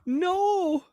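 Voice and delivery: high, drawn-out wail of disbelief; exasperated fan outcry. Acted. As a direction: cry out in pained disbelief at the play. Acted-out fan reaction to the play.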